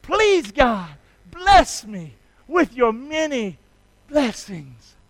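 loud, slow, fervent prayer; leading the church in a repeated prayer. Shown as a demonstration. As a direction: draw out each word for them to repeat. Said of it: "do that one more time".

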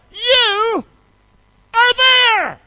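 very high, loud, stretched shout; awed cry to God. Acted. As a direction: cry it out to heaven in amazement. Crying out his realization to God.

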